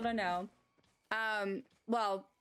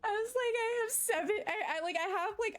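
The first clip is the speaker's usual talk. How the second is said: excited; 10 semitones higher than usual. Retold or imitated. Retelling her own excitement.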